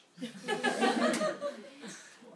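high, loud laugh breaking the imitation; laughing at her own impression; burst of laughter. Real, not acted. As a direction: crack up mid-quote.

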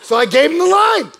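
high, loud proclamation; big comic yell; triumphant shout. Real, not acted. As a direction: shout it out to the crowd. Mock-triumphant shouted aside.

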